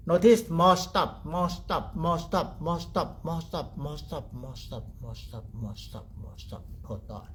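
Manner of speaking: rhythmic repeats sinking from full voice to near silence; fading away. Shown as a demonstration. Teacher showing thought gradually stopping, fading away.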